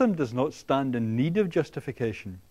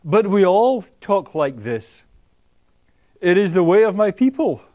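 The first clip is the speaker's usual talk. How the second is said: mock defensive people; 6 semitones higher, 10 dB louder than usual. Lecturer mocking people defending their language, hammed-up. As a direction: puff up and declare it proudly. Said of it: "They say".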